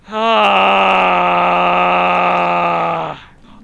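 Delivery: loud, sustained wail of anger; long frustrated scream. Real, not acted. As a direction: let out one long, held scream. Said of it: "this is making me mad".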